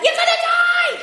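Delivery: shrieking very high and loud in panic; hammed-up panicked shriek; panicked scream. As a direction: scream it like a terrified friend. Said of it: "she starts screaming".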